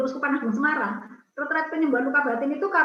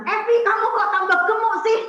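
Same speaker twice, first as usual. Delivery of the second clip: loud auntie greeting; 9 semitones higher, 7 dB louder than usual. Retold or imitated.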